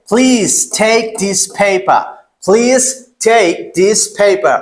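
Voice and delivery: "Please take this paper" slow and separated, puffed p and t; sentence pronunciation drill. Shown as a demonstration. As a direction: model "Please take this paper" deliberately for learners. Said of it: "এখন বাক্যতে আমরা এটা use করব".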